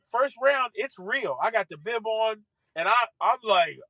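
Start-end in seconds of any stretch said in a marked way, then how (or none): none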